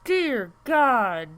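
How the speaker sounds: loud, high horrified exclamation; shocked. Real, not acted. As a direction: recoil in horror as you say it. Recoiling at wound reference photos.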